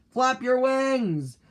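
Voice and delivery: high, drawn-out yell; storybook yell. A narrator's reading. Mother bird urging Junior as he jumps, yelling. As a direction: call it out high, stretching the words. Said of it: "yelled Missus Bird".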